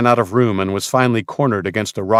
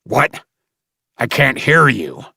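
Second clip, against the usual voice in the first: a higher taunting snarl; 4 semitones higher, 3 dB louder than usual. A narrator's reading. Slaver leader taunting the boy to repeat. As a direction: sneer it, mocking him. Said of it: "snarled the slavers' leader".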